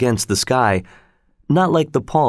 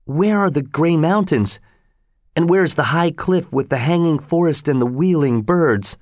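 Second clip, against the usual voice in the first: higher, searching questions to himself; bewildered wondering; 6 semitones higher than usual. A narrator's reading. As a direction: look round for the missing mountains. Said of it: "Then he thought also".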